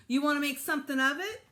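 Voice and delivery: a kid's lightly voiced tough guy. Little boy picks a fight, lightly voiced tough guy. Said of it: "in his best tough guy voice".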